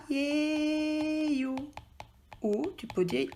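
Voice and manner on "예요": 예요 stretched slow and high; drawn out 예요. Shown as a demonstration. Teacher answering her own question, drawing out 예요. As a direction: hold the 예요 long and high. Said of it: "on met quoi?".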